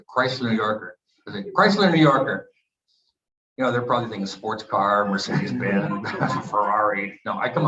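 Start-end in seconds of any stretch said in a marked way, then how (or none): 1.6-2.5 higher, louder burst on the car's name; retold eager exclamation. Teenage player announces his car choice to the team, eager exclamation. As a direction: blurt it out like a kid picking a prize.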